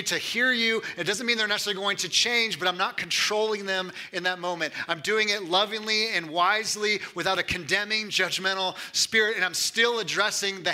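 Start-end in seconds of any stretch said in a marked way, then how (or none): none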